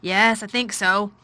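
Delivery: slow and tired, half distracted; slow, gloomy master. A narrator's reading. Craven replies slowly.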